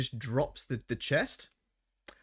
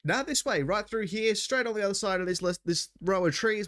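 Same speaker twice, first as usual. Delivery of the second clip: cheery coach driver; 6 semitones higher than usual. Acted. Driver character cheerfully leads on.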